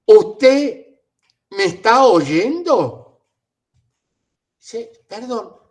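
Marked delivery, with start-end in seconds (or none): 0.1-3.3 put-on shouting slowly at an old man as if he were deaf; gritándole a un anciano. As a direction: yell each word at him like he can't hear. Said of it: "No me hable a los gritos". (le grita al abuelo como si fuera sordo)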